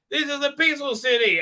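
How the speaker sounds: mock old lady. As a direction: squeak it like a prim old lady.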